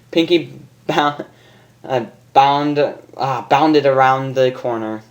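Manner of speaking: usual speaking voice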